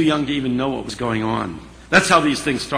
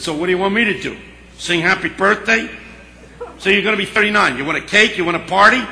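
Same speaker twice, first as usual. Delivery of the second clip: needling, higher-pitched mock questions; sarcastic retort; 6 semitones higher, 3 dB louder, faster than usual. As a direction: toss back rising, scornful questions. Friend mocks her birthday announcement.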